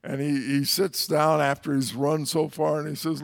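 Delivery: ordinary delivery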